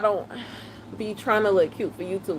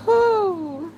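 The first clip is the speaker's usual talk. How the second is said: laughing whew; 10 semitones higher than usual. Real, not acted. Fighting off laughter.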